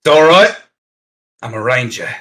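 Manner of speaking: lightly voiced reassuring ranger; ranger in character. Ranger to guards with spears, lightly acted reassurance. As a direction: reassure onlookers in a put-on character voice.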